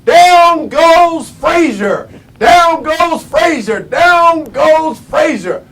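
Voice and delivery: loud, high, drawn-out fight call; Howard Cosell. Retold or imitated. Boxing commentator calling a knockdown to the world, shouted. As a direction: shout it slowly like a stunned ringside commentator. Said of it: "famous saying ... Howard Cosell".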